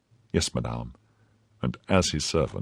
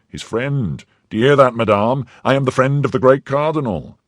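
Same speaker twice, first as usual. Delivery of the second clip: proud husband; 8 semitones higher, 5 dB louder than usual. Acted. Husband boasts of cardinal's favour.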